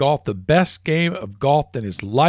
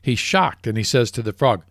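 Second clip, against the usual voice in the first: ordinary talk, nothing marked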